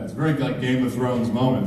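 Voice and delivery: normal talking voice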